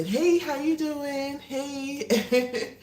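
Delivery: high, slow, sing-song hey; high greeting. Real, not acted. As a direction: greet the viewer high and drawn out. Greeting viewers joining the live.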